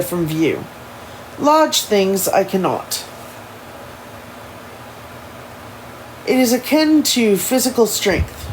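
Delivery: put-on careful proper English accent; formal English accent, deliberately paced; Elijah. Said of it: "in his proper English ins-, inspired accent".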